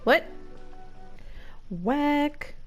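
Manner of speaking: ordinary delivery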